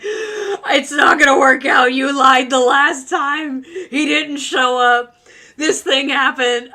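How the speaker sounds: slow, loud sobbing yell; hammed-up tearful yell; screaming and crying. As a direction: wail it out through tears. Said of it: "screaming and crying the whole way".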